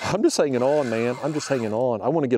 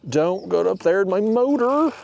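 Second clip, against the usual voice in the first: high, rising call of alarm; urgent plea; 8 semitones higher, 3 dB louder than usual. Real, not acted. Angler to fish heading for his motor, urgent plea.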